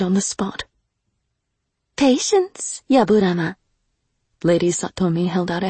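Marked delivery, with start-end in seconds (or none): 1.9-3.5 higher, firm lady's voice; commanding noblewoman. Acted. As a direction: hold the demon back with cool authority. Lady restrains demon.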